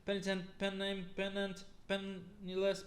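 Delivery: ordinary talk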